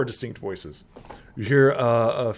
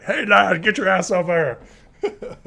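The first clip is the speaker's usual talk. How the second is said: high, loud hammed-up holler; calling out; 10 semitones higher, 11 dB louder than usual. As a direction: yell across the room for the lad. Ulfgar calling Kortek over, acted.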